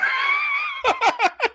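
very high, squealing laughter; shrieking laugh. Real, not acted. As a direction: burst into a piercing high cackle. Laughs after his rant.

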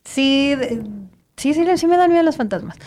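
uneasy, jittery tone; nervous voice. Real, not acted. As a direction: speak like ghosts make you nervous. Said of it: "Es de nervio".

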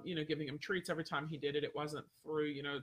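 usual speaking voice

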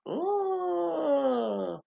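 high, drawn-out mock "ooh" of disgust; mock wince. To her friends about Scorpios, a high mock "ooh". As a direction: squeal it like you can't stand it. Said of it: "I'm just like".

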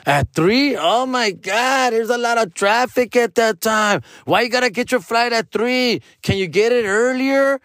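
high, whiny complaining friend; mock his fussy panic about traffic; hammed-up whiny friend fretting over traffic. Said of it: "complaining friend quoted".

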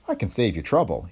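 usual speaking voice

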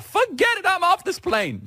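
imitating Sheila Jackson Lee, high; mock high-pitched panic. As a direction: squeal it out, high and indignant. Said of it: "Sheila Jackson Lee would be like".

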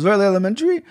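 put-on a light, joking Sherlock Holmes accent; mock Sherlock Holmes accent. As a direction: toss off "Very elementary" in a quick Sherlock Holmes accent. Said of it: "was that a Sherlock Holmes accent?".

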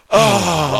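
high, loud put-on exclamation; mock widow's cry. Wife reacting to his death, mock exclamation. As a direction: imitate a wife's reaction, hammed up. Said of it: "she's gonna go".

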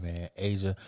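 very low, slow, soft praise; hushed, unhurried deep mumble; smooth, unexcited guy. Retold or imitated. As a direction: imitate him deep and unbothered. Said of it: "He was like".

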